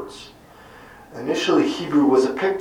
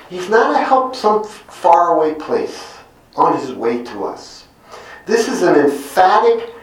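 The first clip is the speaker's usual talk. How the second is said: usual speaking voice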